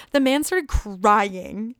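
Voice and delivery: "crying" stretched out very long; slow, incredulous stress on "crying"; dramatic emphasis. Real, not acted. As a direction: draw out "crying" in disbelief. Storyteller stresses astonishing detail.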